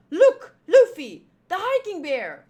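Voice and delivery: loud, high shout to a crewmate; alarmed call-out. Acted. Character alerts crewmate to bear.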